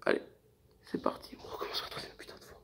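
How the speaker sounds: fast exasperated whisper. Real, not acted. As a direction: whisper through annoyance. Whispered frustration, restarting recording.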